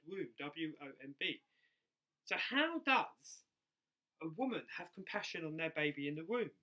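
normal talking voice

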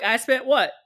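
usual speaking voice

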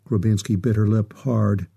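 ordinary delivery, nothing marked

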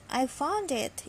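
ordinary delivery, nothing marked

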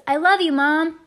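high, loud declaration pushed out; emotional shout to someone far away; heartfelt yell. A narrator's reading. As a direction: push the feeling out in a big yell. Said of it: "I yelled, pushing past the break".

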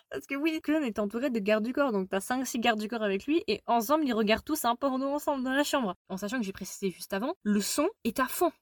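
usual speaking voice

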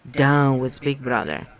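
plain reading voice

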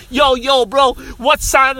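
high, loud, drawn-out holler; mock gang challenge. Teens throwing gang signs, mock loud challenge. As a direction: holler it like a pretend gangster.

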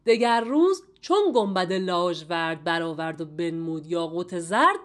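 slow, low verse reading; poem recitation. A narrator's reading. (شعرخوانی حماسی، آهسته و بم)

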